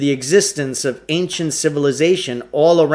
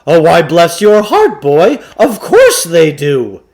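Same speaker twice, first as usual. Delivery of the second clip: put-on higher, louder old huckster, slow and syrupy; folksy salesman Klugarsh; 4 semitones higher, 8 dB louder than usual. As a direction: sweet-talk the boy like a fast-talking shopkeeper. Klugarsh's first line, salesman voice.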